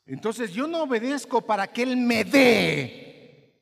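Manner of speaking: slow, loud, high preacher's cry; impassioned sermon voice; full-force preaching. Real, not acted. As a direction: preach it out slowly with fervor.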